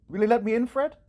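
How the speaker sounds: high, loud hopeful asking; eager plea. Acted. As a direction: beg to be let in, eagerly. Scrooge eagerly begs entry.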